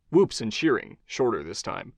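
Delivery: usual speaking voice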